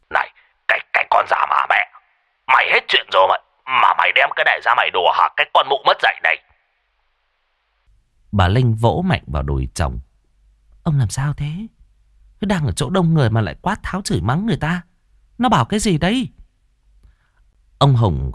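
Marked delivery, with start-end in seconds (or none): 0.1-6.4 indignant cursing, halting then racing; yelling angrily. A narrator's reading. Husband curses the caller for a sick joke, yelling. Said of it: "Ông rú lên một tiếng". 10.8-16.3 high, urgent scolding; reproachful wife. A narrator's reading. Wife scolds husband for swearing in public, reproachful. Vợ trách chồng chửi người.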